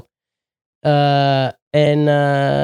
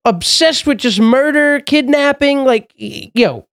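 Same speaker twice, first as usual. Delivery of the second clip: incredulous rant; 9 semitones higher than usual. Real, not acted. Ranting about true crime obsession.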